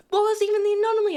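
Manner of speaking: high, loud and fast; a frustrated gamer yelling at the screen; exasperated outburst. Real, not acted. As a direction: blurt it out, fed up with the game. Frustrated at failing the game.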